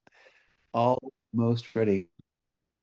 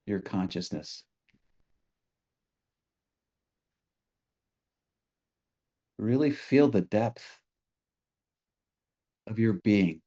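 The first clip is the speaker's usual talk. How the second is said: guided meditation; 2 semitones lower, 3 dB quieter than usual. Real, not acted. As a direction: ease listeners gently into stillness, leaving space between phrases.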